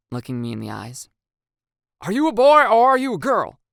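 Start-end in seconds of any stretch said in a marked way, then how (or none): 2.0-3.6 much higher and louder; thrilled, urgent questioning; excited. Acted.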